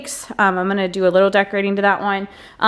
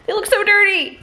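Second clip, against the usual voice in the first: disgusted; 12 semitones higher than usual. Real, not acted. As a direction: squeal out how gross it is. Repeats with high disgust.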